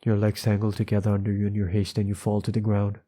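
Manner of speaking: ordinary delivery, nothing marked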